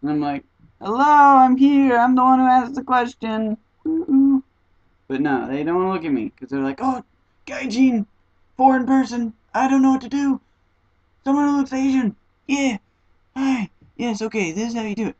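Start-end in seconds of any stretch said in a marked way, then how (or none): 0.8-4.4 high, loud attention-grabbing call; mock-indignant "over here" yell; exasperated calling out. Calling out to be looked at. 6.8-15.2 high-pitched mock panic; hammed-up high, flustered voice; flustered local. Acts out flustered locals avoiding her.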